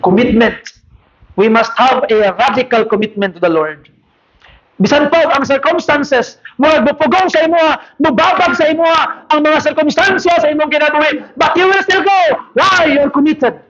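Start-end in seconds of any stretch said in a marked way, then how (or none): 4.8-13.6 high, shouted sermon climax; preaching shout. Acted. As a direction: cry out to the congregation.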